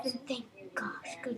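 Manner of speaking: relieved whisper under breath. Real, not acted. Relieved aside.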